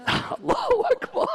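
high sobbing invocation; tearful cry. Real, not acted. Preacher overcome mid-lament, tearful cry. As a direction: break into a real high-pitched sob.

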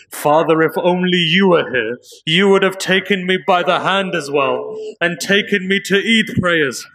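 orphan's lament. Acted. Orphan speaks at father's grave.

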